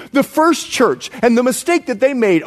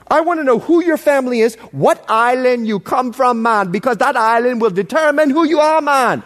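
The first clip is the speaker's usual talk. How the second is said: playful, thick Bahamian lilt; Bahamian accent. Shown as a demonstration. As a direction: let the native Bahamian accent take over. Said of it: "I'm more Bahamian than I am American".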